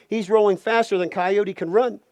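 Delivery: ordinary delivery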